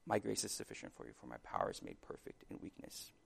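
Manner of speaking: soft, low-volume promise, fading further toward the end; promising near-whisper. Acted. God's competing voice promising grace to the struggling, in a hushed near-whisper. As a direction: speak it gently, almost whispering. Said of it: "it's this whisper maybe".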